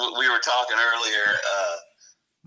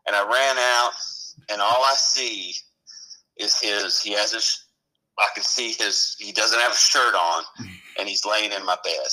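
ordinary talk, nothing marked

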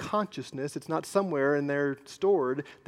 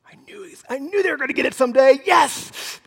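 mock gleeful gloat, higher, louder and fast; 8 semitones higher, 6 dB louder than usual. Pastor mocking a churchgoer's secret glee at another's fall, gleeful gloat. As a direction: crow over someone getting caught. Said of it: "That's pretending and play acting".

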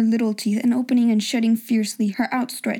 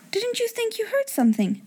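put-on higher girl's voice; 12 semitones higher than usual. Girl asks about noise.

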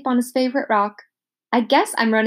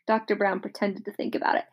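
plain reading voice

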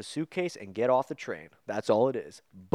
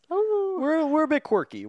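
mock quirky; 4 dB louder, slower than usual. Joking about their antics.

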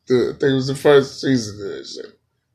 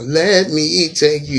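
slow, raised mock singer delivery; 6 semitones higher, 5 dB louder, slower than usual. Comic mimicking the singer's line, slow mock croon. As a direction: draw the hook out loudly in parody. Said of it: "We want [name]".

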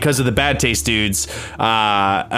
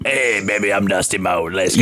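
put-on drunk alter ego; 3 semitones lower than usual. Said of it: "your alter ego, Dusty Malt".

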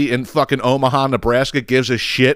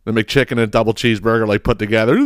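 ordinary talk, nothing marked

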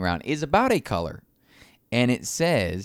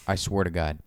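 usual speaking voice

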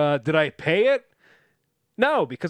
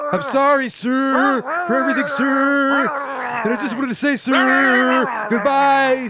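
higher, louder strained shouting to Dad, sir after sir; anxious loud phone call; 8 semitones higher, 6 dB louder, slower than usual. Acted. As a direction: shout respectfully down the phone to a strict father. Hostage talking to stern father.

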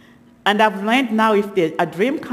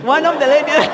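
higher, louder, breaking into laughter; real laughter; 8 semitones higher, 4 dB louder than usual. Real, not acted. Speaker trying to tell what the lady said, really laughing. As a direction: burst out laughing mid-phrase. Cracks up restarting the sentence.

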